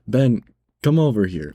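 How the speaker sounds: snarky yell. Retold or imitated. Cashier yelling for the manager to annoy customer, snarky. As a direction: call out with pointed attitude. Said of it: "I yell at him in the snarky voice".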